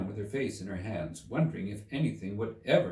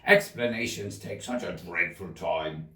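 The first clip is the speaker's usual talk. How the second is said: impatient; 4 semitones lower than usual. Acted. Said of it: "in an impatient tone".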